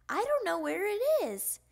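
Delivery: high, loud complaint; little frog at a loss; childish protest. A narrator's reading. Child protests about lost ball.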